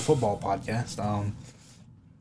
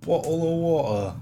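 slower, pitched-up British accent; 5 semitones higher than usual. Shown as a demonstration. Copying the friend's British bit, slow British accent. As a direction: echo it in a drawn-out British accent. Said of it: "I can easily do British".

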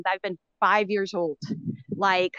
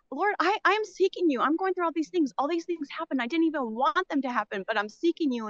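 pleading prayer; 6 semitones higher than usual. Retold or imitated. Caller replays praying to God, pleading high. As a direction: beg the Lord, voice pitched up then dropping.

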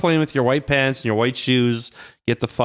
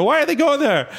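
high mock question; 13 semitones higher than usual.

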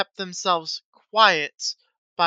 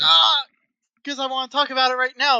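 whiny protest; 7 semitones higher, 6 dB louder than usual. Real, not acted. Whining protest at being put off.

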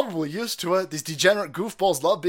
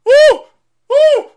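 high whooping shout; loud high woo; cheer; 16 dB louder than usual. Real, not acted. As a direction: whoop like at a fight. Cheering at clip.

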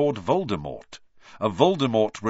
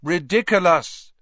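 loud higher chorus of pupils; all shout it together; class chorus; 9 semitones higher, 9 dB louder than usual. A narrator's reading. Whole class repeating the spell after the teacher, loud. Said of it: "said the class together".

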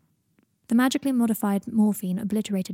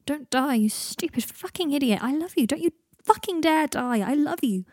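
higher, emphatic, impassioned begging; desperate pleading; 6 semitones higher than usual. A narrator's reading. Harry begs dying Draco not to die, desperately. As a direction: plead fiercely, pitch lifted throughout.

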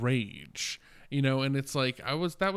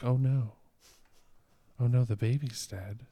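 mock shocked dismay; 6 dB louder than usual. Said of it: "She's like".

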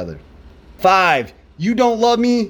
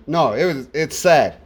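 ordinary talk, nothing marked

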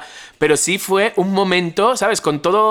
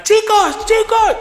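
very high, loud calling out; urgent high yell; acted shout for help; 4 dB louder, much slower than usual. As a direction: imitate panicked yelling for friends. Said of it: "ya empezó a gritar".

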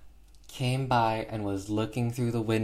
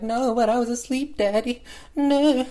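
much higher, loud little girl whine in mock Cockney; mock the actress's childish Cockney pleading; 14 semitones higher, 5 dB louder than usual. Girl insisting to her father she was asleep, high mock Cockney whine. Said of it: "That, that's how she talks".

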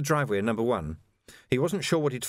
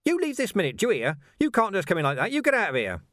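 higher, loud scolding voice; indignant servant; 9 semitones higher, 3 dB louder than usual. A narrator's reading. Housemaid ordering Vimes out, high and indignant. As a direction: drive the intruder out.

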